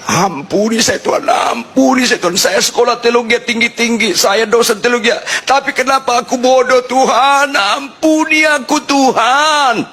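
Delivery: put-on high, crying plea like a little child; mock wailing prayer. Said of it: "nangis meraung-raung kayak anak kecil".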